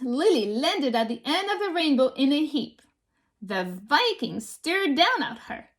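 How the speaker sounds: plain reading voice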